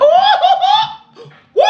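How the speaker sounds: high, loud joyful shriek with a laugh; excited whoop. Real, not acted. Tarot reader to her live viewers, squealing with excitement. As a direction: let out a thrilled squeal. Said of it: "Y'all see I got excited".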